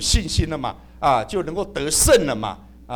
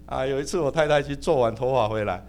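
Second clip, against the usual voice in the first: ordinary delivery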